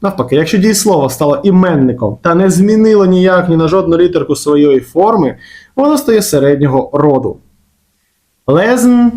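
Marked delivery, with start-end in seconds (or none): none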